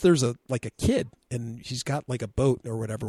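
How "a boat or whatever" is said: ordinary talk, nothing marked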